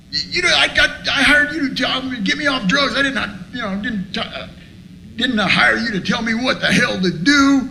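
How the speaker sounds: high, stumbling retold rant; toned-down angry yelling. Man retelling his outburst at sponsor, toned-down angry yelling. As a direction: sputter high with rage, held back in volume. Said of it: "I'm yelling at him".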